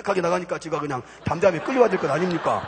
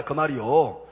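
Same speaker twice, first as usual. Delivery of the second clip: ordinary talk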